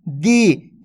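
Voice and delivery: दी with a long, held ee; दी as one sustained syllable; drawn-out long vowel. Shown as a demonstration. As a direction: stretch the vowel in दी. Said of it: "इसी प्रकार से खींच खींच के".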